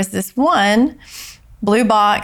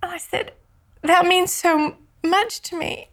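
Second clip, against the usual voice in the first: higher, breaking voice with tears; tearful, near crying; 8 semitones higher than usual. Real, not acted. Telling about her son's death, choked up and tearful. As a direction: fight back tears as you tell it. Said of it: "I just started to cry".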